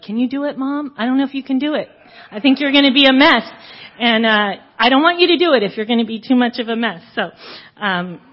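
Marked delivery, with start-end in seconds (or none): 0.0-7.0 higher, louder and fast, a daughter fretting at her mom; retelling the daughter's anxious plea, pitched up and rushed; worried daughter. Retold or imitated. Daughter begging her mom not to cry at the wedding, anxious and fast. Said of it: "she was like".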